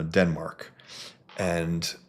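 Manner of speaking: normal talking voice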